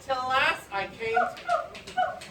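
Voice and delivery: suspended, slow high delivery; drawn-out repeat. A narrator's reading. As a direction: lift the pitch and stretch the line out. Line repeated, higher and slower.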